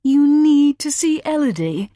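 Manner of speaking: high, loud scornful echo; contemptuous mockery. A narrator's reading. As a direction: throw her words back with contempt. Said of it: "a look of contempt".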